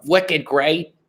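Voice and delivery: high mock Boston accent. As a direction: put on thick Boston slang. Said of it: "they have this really thick accent".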